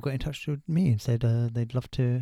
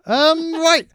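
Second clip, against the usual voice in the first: laughing; 11 dB louder than usual. Real, not acted. Laughing while wrapping up.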